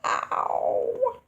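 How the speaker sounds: high whispered little ow; fish going ow. Pretend. Playing the fish rammed by Enosaurus, tiny high ow. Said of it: "that fish is going".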